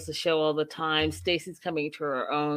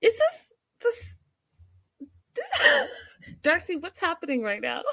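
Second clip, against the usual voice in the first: high squeaky laughing question; can't stop laughing while asking; laughing disbelief; 11 semitones higher than usual. Retold or imitated. Recapper watching Darcy's skit, laughing high and squeaky. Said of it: "and I'm like".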